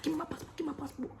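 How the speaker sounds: shrill mock demand; yelling it in a high voice; high-pitched demand.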